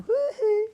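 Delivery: high falsetto "hee hee"; pop-star falsetto whoop, high and loud. Acted.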